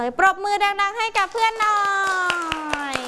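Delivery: high-pitched, rousing call for applause; cheering praise. Real, not acted. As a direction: get the whole class clapping. Teacher cheering, calling for applause. (เสียงสูง ชมอย่างร่าเริง)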